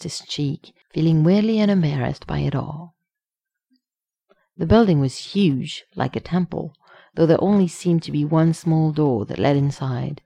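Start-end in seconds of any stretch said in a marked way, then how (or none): none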